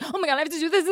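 frazzled, overwhelmed whine; mock panic. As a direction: act flustered. Acting herself overwhelmed.

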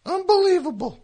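ordinary delivery

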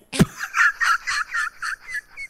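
high burst of real laughter; big laugh. Real, not acted. Caller bursts out laughing after confessing her secret. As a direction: crack up at your own story.

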